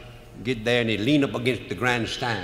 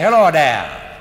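hearty greeting; 3 semitones higher, 10 dB louder, slower than usual. Retold or imitated. Murphy greets the game warden.

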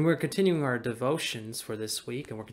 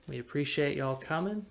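normal talking voice

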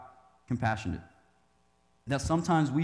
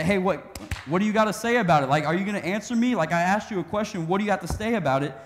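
louder, higher, fast demand; pushy Pharisee heckling, louder and quicker; demanding challenge; 5 semitones higher, 5 dB louder than usual. Retold or imitated. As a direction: press him hard for an answer. Pharisees pressing Jesus for answer.

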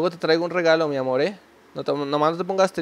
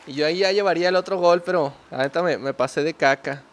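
ordinary delivery

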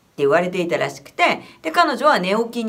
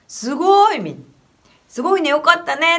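higher, louder happy praise; delighted praise; 7 semitones higher, 5 dB louder than usual. Retold or imitated. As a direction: cheer her on with joy. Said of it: "喜んだんですけど".

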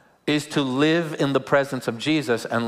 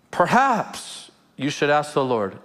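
normal talking voice

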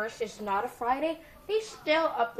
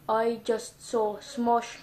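ordinary delivery

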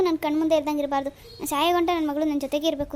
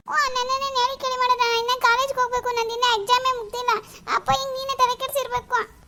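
high, weepy accusation; tearful; 8 semitones higher than usual. Acted. Daughter tearfully blames mother for the match. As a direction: cry and blame at once.

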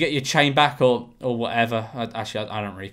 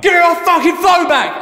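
much higher, louder furious shout; angry demand; 13 semitones higher, 7 dB louder than usual. Retold or imitated. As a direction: bark it at the thieves. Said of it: "They turn around".

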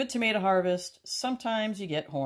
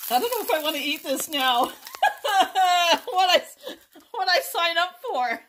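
laughing; 7 dB louder than usual. Real, not acted. Laughing at eating the worm.

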